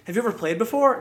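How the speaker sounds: high innocent question, genuinely asking; high, sincere question. Retold or imitated. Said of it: "genuinely was like".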